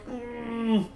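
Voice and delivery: high, smug mock pitch meeting; mocking the devs in a dopey voice; mocking developer voice. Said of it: "and then they were like".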